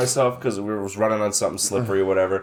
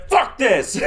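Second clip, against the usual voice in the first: frustrated outburst; 7 semitones higher than usual. Retold or imitated. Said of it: "I'm fucking slamming it".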